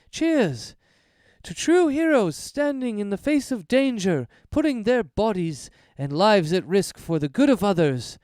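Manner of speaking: high, ringing toast to true heroes; Errol's toast. Acted. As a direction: lift the glass and rally the heroes. GM voices Errol.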